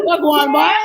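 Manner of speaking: high, loud granny voice; imitating grandma again. Friend quoting the grandma, high and loud. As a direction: call out like the old grandma. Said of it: "She said".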